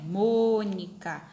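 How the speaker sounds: first syllable of "Mônica" long and loud; "Mônica" with a stretched, punched opening "Mô"; stress on MÔ. Shown as a demonstration. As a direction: lean hard on the "Mô" of "Mônica". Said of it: "É o mo".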